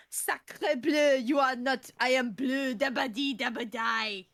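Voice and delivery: loud hammed-up French accent; mock French accent. Reading a silly note aloud in a loud mock French accent. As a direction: belt it in a cartoon French accent. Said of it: "let me try and do this accent".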